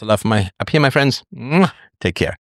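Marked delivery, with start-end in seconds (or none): 1.3-1.7 affectionate sign-off kiss noise; blown kiss. Real, not acted. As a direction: send a higher-pitched air kiss to listeners. Blows a kiss signing off.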